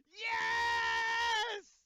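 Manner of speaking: shrieking, very high and loud; ecstatic screaming. Real, not acted. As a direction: scream with pure joy. Joyous scream.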